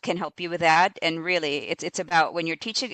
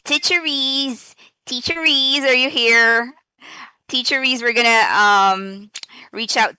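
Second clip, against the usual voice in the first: higher, louder, excited calling out the name; calling out the winner; 6 semitones higher, 5 dB louder than usual. Real, not acted.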